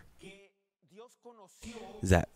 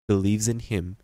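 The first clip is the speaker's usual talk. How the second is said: ordinary delivery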